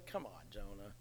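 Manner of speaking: ordinary talk, nothing marked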